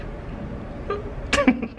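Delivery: a quick high giggle at herself; short giggle. Real, not acted. Vlogger laughing at herself after admitting she can't see. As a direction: laugh it off lightly. Giggling at own blind selfie.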